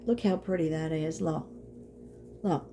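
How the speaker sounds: ordinary delivery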